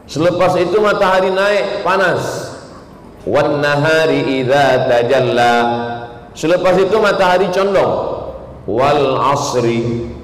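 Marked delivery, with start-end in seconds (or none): 3.2-5.5 slow chanted Quran recitation, drawn out. Acted. Preacher to his congregation, intoning Quran verses in a slow chant. 8.7-10.1 slow chanted Quran recitation, drawn out. Acted. Preacher to his congregation, intoning Quran verses in a slow chant.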